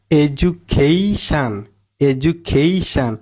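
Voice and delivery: Education slow, with a long stressed "kei"; stress before "shan". Shown as a demonstration. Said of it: "জোর দিয়ে বলতে হবে". (Education, "কেই"-তে জোর দিয়ে)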